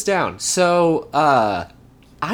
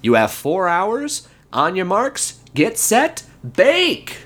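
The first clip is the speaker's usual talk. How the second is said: Don Crier, building to shout; 6 semitones higher, slower than usual. Acted. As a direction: start slow and grand, bellow bake. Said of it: "Don Crier finally reached the moment".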